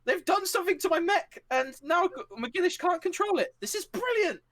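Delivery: very high, gleeful squeal; hammed-up high-pitched excitable pilot; Shah. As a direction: crow with manic delight. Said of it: "and you hear Shah go".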